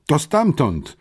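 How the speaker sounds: high, urgent exclamation; crying out. A narrator's reading. Sister locating the banging noise, crying out. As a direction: call out sharply without a full shout. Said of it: "krzyknęła Marta".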